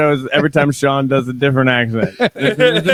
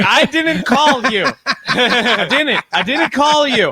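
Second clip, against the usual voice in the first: higher, slow, laughing denial; flustered denial; 6 semitones higher, slower than usual. Retold or imitated. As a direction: deny it, flustered and worn down. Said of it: "very flustered, just defeated".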